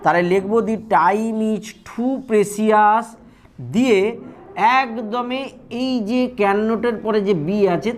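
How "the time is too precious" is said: each word spaced out for copying; slow dictation. Shown as a demonstration. Teacher dictating an example sentence to students, slow and deliberate. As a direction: read it out slowly for students to write.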